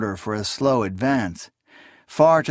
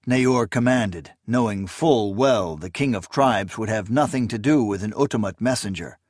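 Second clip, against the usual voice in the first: usual speaking voice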